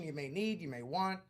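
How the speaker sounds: ordinary delivery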